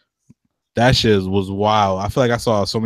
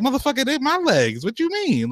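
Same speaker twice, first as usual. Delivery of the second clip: mock outraged; 13 semitones higher than usual. Acted indignant reply to girlfriend.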